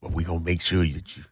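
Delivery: low, soft plantation-master imitation; teasing low master voice; mock massa voice. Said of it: "you saying it like massa".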